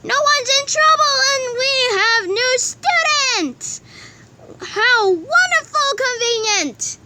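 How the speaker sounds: high, slow gushing; overjoyed. Acted. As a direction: swoon with relief, high. Relieved at the letter.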